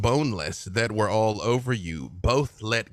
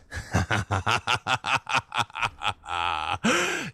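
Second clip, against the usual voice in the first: villain laugh. Acted. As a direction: laugh down at the fool. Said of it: "and just says".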